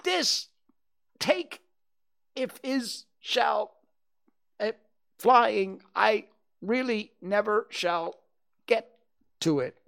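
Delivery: high, squeaky-pitched words broken by pauses; bounced about, getting out a word at a time; jerky, jolted speech. A narrator's reading. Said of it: "he thought jerkily to himself".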